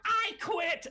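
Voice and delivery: very high, loud declaration; defiant outburst. Acted. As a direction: blurt it out in a squeal. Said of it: "and he's like".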